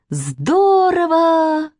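high, long drawn-out cheer; delighted child's cry. A narrator's reading. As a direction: cry out gleefully like a girl. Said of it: "закричала Катя". (восторженный протяжный детский крик)

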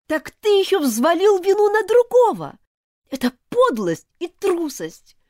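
high, loud outraged accusation; angry and contemptuous. Acted. Elizabeth accuses Martin of theft, high and furious. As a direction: hurl it at him, betrayed and furious. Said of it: "сама ещё больше разозлилась".

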